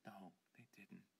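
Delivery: soft, calming hush; calm him down gently; soothing. Acted. Friend softly soothing the panicked man.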